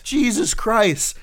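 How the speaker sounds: slow, drawn-out high exclamation; exasperated. Real, not acted. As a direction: stretch it in disbelief. Exasperated reaction.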